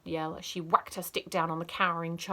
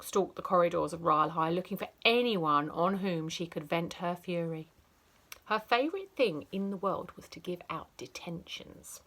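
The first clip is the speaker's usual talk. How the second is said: usual speaking voice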